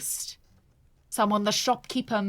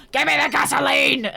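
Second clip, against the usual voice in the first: loud, pushy shout; shouted demand; 4 semitones higher, 9 dB louder than usual. Acted. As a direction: yell it like a threat. Acting out a threat.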